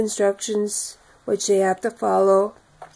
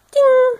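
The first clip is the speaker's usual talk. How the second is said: bell ding; 7 dB louder than usual. Retold or imitated. Mimics the notification bell.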